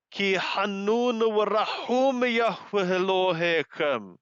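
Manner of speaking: ordinary talk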